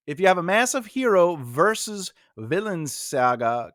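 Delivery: ordinary talk, nothing marked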